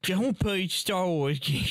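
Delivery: slow, put-on kid's whine; possessive child impression. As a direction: warn others off like a pouting child. Mock child guarding the game.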